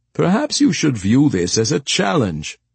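lightly voiced persuasive steward; suggesting steward. A narrator's reading. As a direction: float a tactful suggestion in a lifted voice. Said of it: "suggested Temagast".

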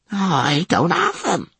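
high, slow and choked; panicked old attendant. Acted. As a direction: plead it out in panic.

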